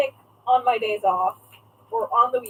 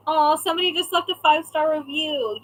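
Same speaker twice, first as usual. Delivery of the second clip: normal talking voice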